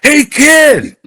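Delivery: big high holler, drawn out. Retold or imitated. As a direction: yell it high at full volume. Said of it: "He yells louder".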